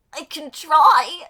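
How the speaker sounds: loud, high, drawn-out attempt at resolve; hammed-up reply. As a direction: blurt it out high and slow. Acted reply of reluctant magician.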